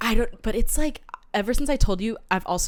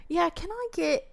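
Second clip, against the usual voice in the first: put-on higher, slow British accent; British accent, drawn out and lilting; 9 semitones higher, 5 dB quieter than usual. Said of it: "slip into this accent".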